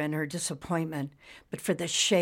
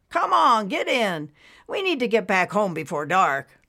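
louder come-on call; lightly voiced husband urging wife; 4 semitones higher, 5 dB louder than usual. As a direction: hurry her into the car. Husband urges her in.

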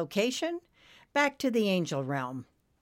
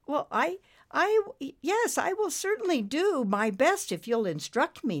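high, stammered agreement; falter, then willingly agree; lightly voiced eager helper; hesitant cloud; 10 semitones higher than usual. A narrator's reading.

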